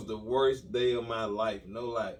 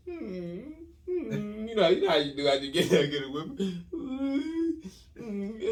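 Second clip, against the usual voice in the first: mock whimpering; 6 semitones higher than usual. Whimpering after whipping.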